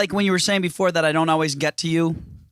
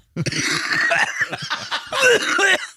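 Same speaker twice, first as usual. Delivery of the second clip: strained wordless squeal, very high; high note retried. Shown as a demonstration. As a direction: push out a cracked high note, then give up. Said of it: "Do that again".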